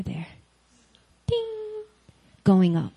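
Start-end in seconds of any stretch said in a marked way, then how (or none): 1.3-1.8 high, soft, ringing "ding"; elevator ding. Retold or imitated. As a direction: imitate a lift bell chiming. Imitates elevator bell.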